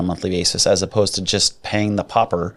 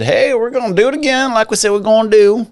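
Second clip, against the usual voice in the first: put-on Southern accent; 12 semitones higher, 3 dB louder than usual. Host mimics Fed chair Powell in a Southern accent. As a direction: play Powell as a high folksy Southerner. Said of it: "I don't know why I went Southern with that".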